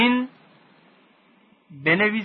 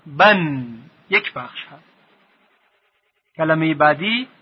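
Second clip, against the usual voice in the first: ordinary delivery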